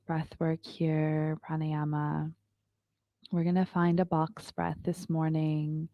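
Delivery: calm, unhurried yoga teacher voice; guided meditation. Acted. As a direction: guide the class into stillness. Leads a yoga breathing meditation.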